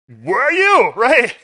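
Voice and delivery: loud, high call across the room. Real, not acted. Preacher calling out to a listener in the back, loud. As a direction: shout to find someone, laughing.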